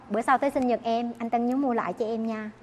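soft, coy little request; coaxing hint. Retold or imitated. A giver softly asks for a return gift. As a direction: wheedle quietly for a gift back.

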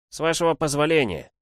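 put-on high, formal boy's voice; courteous boy. A narrator's reading. Boy's courteous request to the beasts. (учтиво, высоким голосом)

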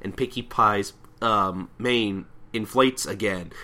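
ordinary talk

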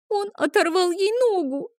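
put-on high-pitched, tearful child's wail; lightly acted whimper of an upset girl; sobbing little girl. A narrator's reading. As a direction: complain through tears in a high child voice. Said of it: "схлипнула Адель".

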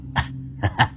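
breathy evil chuckle; a menacing unvoiced laugh; sinister laugh. Possessed man laughing at the monk, sinister. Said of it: "cười lên hành hạch".

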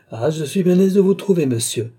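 high, loud voice of a schoolmaster; tutor's tone. A narrator's reading. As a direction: sound like a tutor catching a pupil at fault. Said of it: "du ton d'un précepteur qui cherchait". (ton de précepteur)

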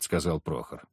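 ordinary delivery

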